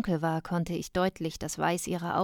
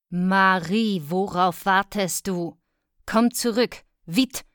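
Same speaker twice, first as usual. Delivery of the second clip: loud, impatient calling; impatient command; 8 dB louder, slower than usual. Acted. Mother ordering her dawdling daughter back, impatient.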